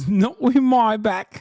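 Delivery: high, loud, slow parody; mocking mimicry. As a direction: echo his line as a drawn-out caricature. Mimicking the clip just played.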